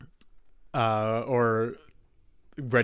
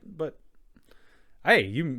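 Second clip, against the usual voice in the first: ordinary talk, nothing marked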